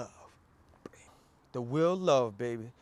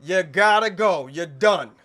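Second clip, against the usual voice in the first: louder, final and decisive; firm loud dismissal; 4 semitones higher, 8 dB louder than usual. Acted. Reviewer loudly cutting off friends after the movie. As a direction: slam the door on every friend.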